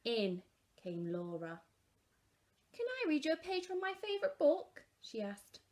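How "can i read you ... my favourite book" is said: put-on high, eager little girl; child's voice. A narrator's reading. Laura asking Mum in the bath, high eager child. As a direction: ask hopefully like a child.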